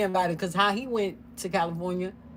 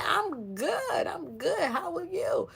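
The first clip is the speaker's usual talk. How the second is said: very high singsong answer; imitated friendly reply; 12 semitones higher, slower than usual. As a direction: mimic a sweet, gushing reply. Retells sister-in-law's friendly reply.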